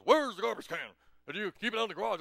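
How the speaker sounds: hearty put-on dignitary; President Obama. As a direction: call out the question as a visiting statesman. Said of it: "and he goes".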